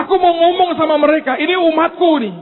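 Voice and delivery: high, loud proud declaration; proud proclamation. Acted. God proclaiming.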